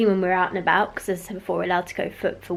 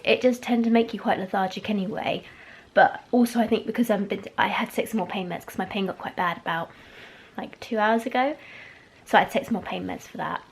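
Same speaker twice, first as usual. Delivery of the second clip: ordinary talk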